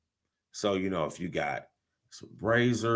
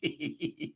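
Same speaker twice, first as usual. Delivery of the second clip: quiet, high giggle; chuckling; 5 semitones higher, 14 dB quieter than usual. Real, not acted. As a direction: let out a soft little laugh. Laughing at a listener's comment.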